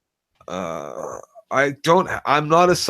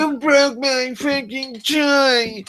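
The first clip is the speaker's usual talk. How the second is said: very high, drawn-out comic scream; 13 semitones higher, much slower than usual. Acted. Joe acting a broken-toy outburst, high scream. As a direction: wail it out at the top of the range. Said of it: "your scream".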